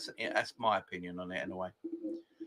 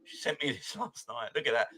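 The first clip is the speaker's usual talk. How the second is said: ordinary talk, nothing marked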